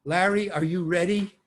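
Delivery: mild mock shout; retold director's yell. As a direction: call him from behind the camera. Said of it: "And Don Siegel yells".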